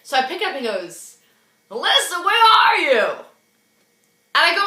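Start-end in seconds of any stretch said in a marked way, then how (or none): 1.7-3.0 high, raised imitation of his call; demanding caller. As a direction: call out insistently as the man phoning. Acted phone call from Brad.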